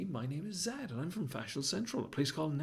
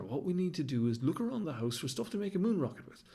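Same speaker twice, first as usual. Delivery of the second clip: plain reading voice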